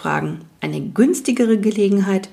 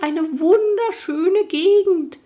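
night goblin voice; 11 semitones higher, slower than usual. Acted. Goblin enthuses.

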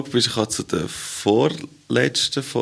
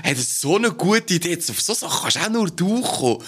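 high, gushing fake admiration; mock ass-kissing praise; 6 semitones higher than usual. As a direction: lay the flattery on thick. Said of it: "So arschkrüchend nett".

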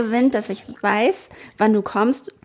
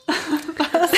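laughing; 7 semitones higher than usual. Real, not acted. Breaking off laughing.

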